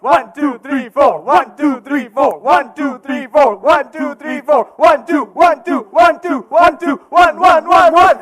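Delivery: loud, high shouted count; shouting. Real, not acted. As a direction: bark out the count. Shouted count during shakedown.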